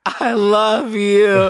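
drawn-out, high, loud mock declaration; hammed-up wail. Stoned teen on the phone to mom, hammed-up wail. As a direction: stretch it into a desperate howl.

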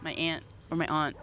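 "aunt" said first like "ant", then broad "ahnt"; low, soft demo of both ways to say "aunt"; two pronunciations. Shown as a demonstration. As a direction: offer both vowels for "aunt". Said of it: "It depends".

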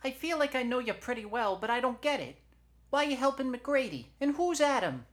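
very high, loud, puzzled falsetto; Nomar. Acted. Shoulder questions Eugenia's motives, high and loud. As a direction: pester loudly up high.

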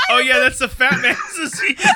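laughing and loud while quoting. Retold or imitated. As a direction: retell the line through laughter. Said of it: "someone told me".

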